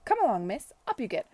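put-on loud, brisk matron ordering a girl up; brisk command. Lady Denham ordering listless Esther up, loud and brisk. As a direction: chivvy her up like a stern lady.

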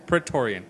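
Praetorian said clearly as pray-TOR-ee-an, falling; teaching pronunciation. Shown as a demonstration. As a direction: model Praetorian for him to repeat. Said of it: "Say it with me".

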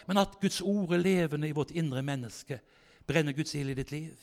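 ordinary talk

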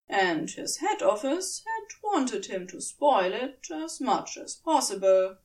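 usual speaking voice

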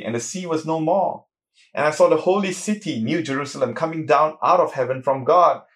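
solemn preacher reading scripture. Acted. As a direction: read it out to the congregation with weight. Reads Revelation aloud in sermon.

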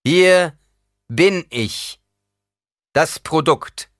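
loud, high-pitched public proclamation. A narrator's reading. Paul addressing his comrades about the Reverend Mother, loud. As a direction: call it out to everyone present. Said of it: "Lauter sagte Paul".

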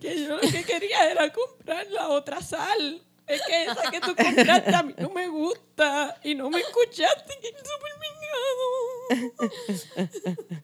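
high, wailing mock sob; mock crying. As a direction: ham up a tearful breakdown. Mock tearful rant.

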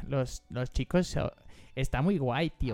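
lightly put-on Spain Spanish accent, slow; a Mexican playing at Castilian Spanish. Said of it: "como si fueras andaluz". (acento español de España leve, lento)

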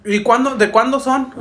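mock maternal interrogation; imitated scolding mom. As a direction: demand an answer like a cross mother. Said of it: "me empezó a regañar".

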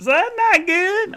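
high, loud, slow "not good"; mock dismay. Commenting on the returned bed, hammed-up dismay. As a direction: overplay the disappointment for laughs.

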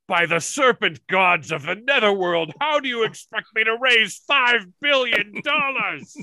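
lightly hammed villain, rising high on the second line; hammed-up Serpentor. A fan quotes the snake emperor's outburst, mock grandiose. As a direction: declaim it like a pompous cartoon tyrant. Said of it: "Serpentor's quote".

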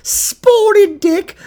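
very high, slow drawn-out spotted dick; mock posh. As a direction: lilt it up like a fancy menu item. Savoring the silly dish name.

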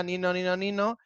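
imitated passing siren. Shown as a demonstration. Telling how an ambulance passed by, siren imitation. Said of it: "pasó la ambulancia".

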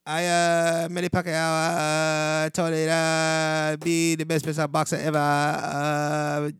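slow, droning mock Filipino accent. Host bragging as Manny Pacquiao, drawn-out Filipino accent. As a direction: stretch it out in a put-on Filipino accent.

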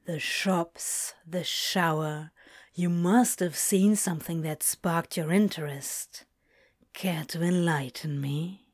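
low, soft, suggestive purr. A narrator's reading. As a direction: coax him softly and teasingly. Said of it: "Crowley purred".

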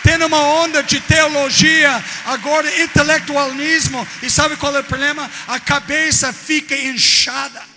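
loud fiery preaching; preaching at full force. Acted. Preacher shouting at the congregation, fiery and loud. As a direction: shout it from the pulpit.

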